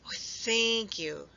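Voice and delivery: slow, glowing thank you; beaming thanks. A narrator's reading. Squirrel thanking Hedgehog for help, slow beaming thanks. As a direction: let the gratitude spill out. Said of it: "beamed Squirrel".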